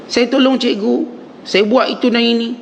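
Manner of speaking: high, upset student demanding an answer; a mock-angry pupil protesting to his teacher. Student protests failed exam to teacher, mock-angry. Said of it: "Dia pun marah cikgu dia".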